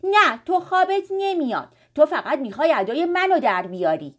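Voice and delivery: loud. A narrator's reading. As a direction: argue back loudly and crossly. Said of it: "آریان با صدای بلند گفت". (با صدای بلند)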